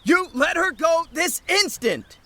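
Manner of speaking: high, slow furious yell; furious demand. Acted. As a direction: command the captor with full rage. Boyfriend confronts captor.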